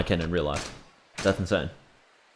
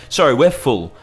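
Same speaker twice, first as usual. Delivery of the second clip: mock waiter; 5 semitones higher, 6 dB louder than usual. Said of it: "he's like".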